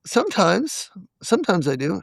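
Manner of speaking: high, tentative confession; sheepish admission. Retold or imitated. Husband admitting it to his wife, sheepish.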